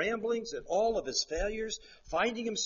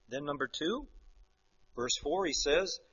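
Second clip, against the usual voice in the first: ordinary talk, nothing marked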